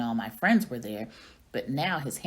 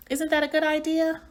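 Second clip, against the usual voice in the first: excited question; 13 semitones higher, 5 dB louder than usual. Acted. Hamster asks frog excitedly.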